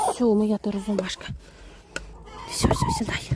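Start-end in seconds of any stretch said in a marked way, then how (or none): 2.5-3.3 hushed whisper to settle her; soothing whisper. Real, not acted. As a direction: whisper her gently onto the nest. Soothing hen onto nest. (заспокійливий шепіт)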